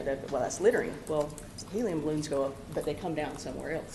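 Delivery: ordinary delivery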